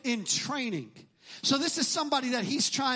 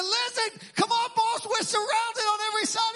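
higher, urgent pleading as if retelling panic; a frightened servant begging his master to wake; alarmed servant; 7 semitones higher than usual. Retold or imitated. Servant waking Elisha about the army, urgent and alarmed. Servant wakes prophet, surrounded.

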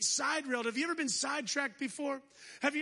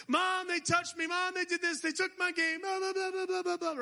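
put-on nagging kid; 5 semitones higher than usual. Preacher voices nagging kids.

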